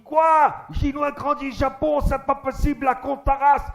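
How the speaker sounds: put-on high, loud indignant caricature Japanese accent; mock outrage in accent. Unhappy old Japanese man protesting, outraged. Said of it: "il était pas content".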